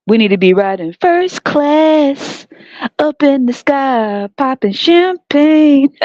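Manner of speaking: drawn-out luxuriating. Real, not acted. Telling the women how they should be riding, drawn-out and luxuriating. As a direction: stretch it out like a lavish daydream. Sing-song dream of first-class travel.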